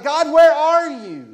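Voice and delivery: loud, high, drawn-out cry; crying out to God. Acted. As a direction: shout it desperately up to heaven. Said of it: "they just cried out to God".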